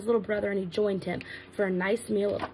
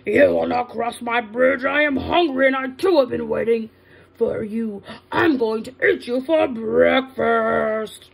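raging troll; 6 dB louder than usual. Acted. Troll threatens to eat the big goat, loud rage. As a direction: bellow each threat at the goat. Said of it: "The troll shook all over".

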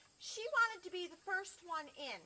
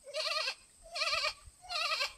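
high, loud quavering bleat; goat bleat; 13 semitones higher, 8 dB louder, much slower than usual. Acted. Goat asks the librarian for something, bleating loudly. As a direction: bleat three times like a goat. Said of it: "Goat looked at that".

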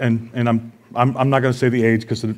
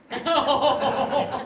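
high laugh; 12 semitones higher, 10 dB quieter than usual. Real, not acted. Laughs at own punchline.

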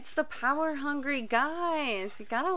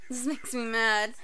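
ordinary talk, nothing marked

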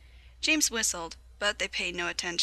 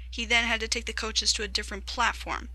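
ordinary delivery

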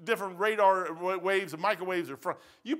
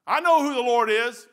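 louder, higher, insistent claim; mock assertive believer; 5 semitones higher, 8 dB louder than usual. Preacher mimicking people still bound by sin, insistent. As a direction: imitate someone loudly claiming faith. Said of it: "you just hear it in their voice".

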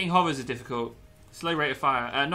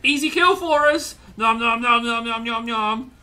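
gleeful gloating; 7 semitones higher, 9 dB louder than usual. Real, not acted. Gloating over easy kills.

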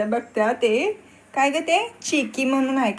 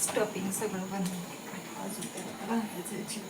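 far off; 4 semitones lower, 16 dB quieter than usual. Real, not acted. Mother speaks off-mic.

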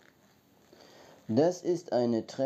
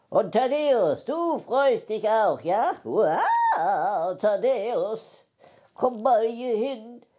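high calling voice; 6 semitones higher, much slower than usual. Pretend.

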